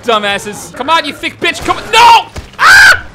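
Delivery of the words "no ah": sharp, very high, loud yelp of fright; sudden scream. Real, not acted. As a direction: shriek as you get hit. Sudden attack in game.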